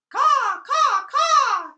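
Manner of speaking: loud, high crow caws; shrill cawing crow; croaking crows. Acted. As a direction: caw like a harsh crow. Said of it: "continued the crows".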